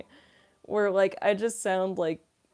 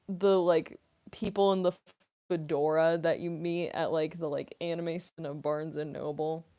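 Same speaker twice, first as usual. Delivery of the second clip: normal talking voice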